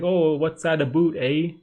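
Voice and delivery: imitation Canadian, long oo in "a boot"; super Canadian accent. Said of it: "that super Canadian accent".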